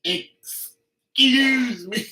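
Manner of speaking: slow, high, drawn-out offended excuse me; high, slow mock-offended demand; indignant excuse me.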